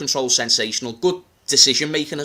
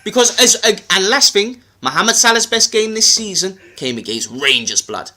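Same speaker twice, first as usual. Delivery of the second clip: emphatic, higher-pitched London patter; London accent; 3 semitones higher, 3 dB louder than usual. Acted. Making his case to Matisse, in a London accent. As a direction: argue the case in street London. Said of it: "I'll do it in my London accent".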